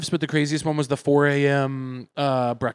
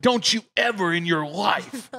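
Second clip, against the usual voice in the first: higher-pitched, imitated angry dressing-down; yelling boss; 6 semitones higher than usual. Boss telling off his teenage employee, mock yelling. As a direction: scold like an outraged boss, without full volume. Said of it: "yelled at me".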